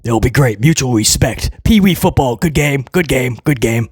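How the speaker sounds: low, dismissive voice imitating the boss; mock Hunter. Mocking the booker, low and dismissive. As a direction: brush it off in a low, lazy Hunter voice. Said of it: "And Hunter's like".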